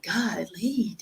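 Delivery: a breathy sarcastic whisper, barely voiced; mock whisper. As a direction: hush it like a fake sympathetic aside. Sarcastic whisper in retold chat.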